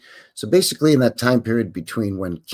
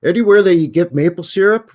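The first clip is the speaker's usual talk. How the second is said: mock Canadian boast; 7 semitones higher, 8 dB louder than usual.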